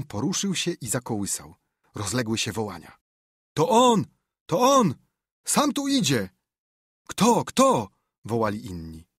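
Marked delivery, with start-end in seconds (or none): 3.5-7.9 high, loud cries of a crowd spotting him; shouted crowd alarm; excited crowd shouting. Acted. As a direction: call it out to the whole crowd. Crowd spots the suspect.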